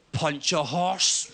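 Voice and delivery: put-on slow, deadpan drunk answer; slowly deadpan the drunk's reply; lightly voiced hammered bloke. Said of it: "this guy goes".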